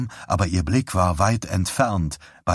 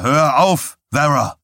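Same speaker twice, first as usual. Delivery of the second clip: raised, loud voice cutting in; sharp command; 7 semitones higher, 5 dB louder than usual. A narrator's reading. Husband orders hysterical Vera to stop, sharp shout. Husband cuts off her raving.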